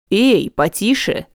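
slow, lightly voiced man; a gentle male warning; young man voice. A narrator's reading. Seamus stops her on stairs.

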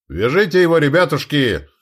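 high, loud call to his men; exclaimed order. A narrator's reading. Duke calling his men to seize him, exclaiming. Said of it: "воскликнул герцог".

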